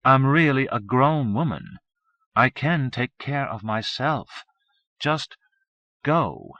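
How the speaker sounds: she's had enough of him; exasperated. A narrator's reading. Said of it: "sounding exasperated".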